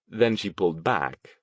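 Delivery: ordinary delivery, nothing marked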